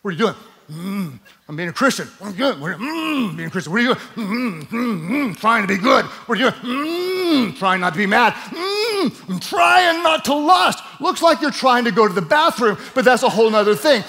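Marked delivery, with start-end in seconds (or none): 0.0-10.8 strained, grunting mock piety. Preacher acting a believer straining to be good, mock strain. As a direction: ham up holding it in.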